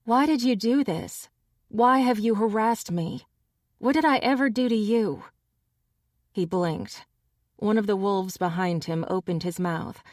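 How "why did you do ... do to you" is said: higher, fast and pleading; lightly voiced upset young woman; demanding. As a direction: demand an answer. Tabby demands answers.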